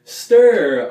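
loud, high punched stir; punchy stir. Shown as a demonstration. Teacher shouting stir as she stirs the soup. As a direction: hit the word stir hard. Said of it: "We're gonna mix it up".

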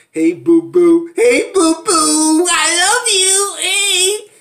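high, slow, drawn-out crooning; mock lover voice. Vlogger mocking Michael sweet-talking Megan, slow high croon. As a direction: stretch every word like a cheesy sweet talker. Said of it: "talking about".

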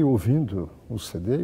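ordinary delivery

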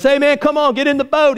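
high, urgent mock shout; acted yell from a rescuer; retold yell. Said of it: "looks in, yells at him".

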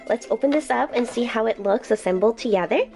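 ordinary talk

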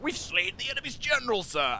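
mock soldier report. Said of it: "charge in there and be like".

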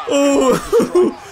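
high laughing "oh"; real giggling aftershock. Real, not acted. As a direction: let the laugh spill out after a high cry. Still cracking up at the moment.

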